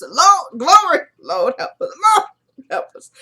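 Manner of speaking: high, swooping cry to the Lord; hammed-up imitation of a wailing plea; mock hysterical prayer. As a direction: wail it in mock distress, pitch leaping up. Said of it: "I, she did this".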